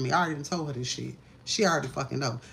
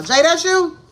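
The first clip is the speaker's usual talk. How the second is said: high, loud call across the room; 12 semitones higher, 10 dB louder than usual. Real, not acted. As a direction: shout to someone in the next room. Calls out to someone off-camera.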